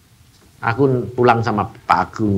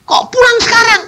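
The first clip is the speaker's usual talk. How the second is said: high, loud shocked question; shocked cry to God; 4 dB louder than usual. Retold or imitated. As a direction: cry out in disbelief. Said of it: "aku bilang". (teriakan kaget kepada Tuhan)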